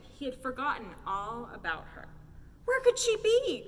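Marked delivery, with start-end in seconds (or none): 2.6-3.6 high, loud frantic question; panicked cry. A narrator's reading. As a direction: cry out in alarm. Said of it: "cried the sheriff".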